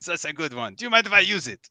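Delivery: chatty, French-lilted literary critic; French-accented mouse knight, encouraging. Acted. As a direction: praise a fellow poet in a French-tinged accent.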